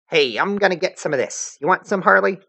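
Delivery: put-on brash high girl voice; cocky teen troll girl; Vriska voice.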